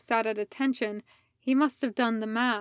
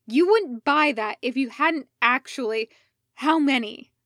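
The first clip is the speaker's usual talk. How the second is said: angry demand; 3 semitones higher, 7 dB louder than usual. Acted. Fives confronting Obi-Wan angrily.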